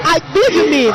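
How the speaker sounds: ordinary talk, nothing marked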